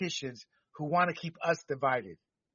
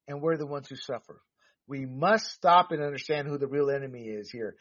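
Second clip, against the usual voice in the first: ordinary talk, nothing marked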